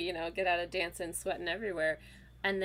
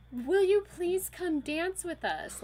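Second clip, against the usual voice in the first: higher, slow, drawn-out plea; pleading invitation; 10 semitones higher, 5 dB louder, slower than usual. Retold or imitated. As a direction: coax her onto the floor. Elaine pleading with stranger.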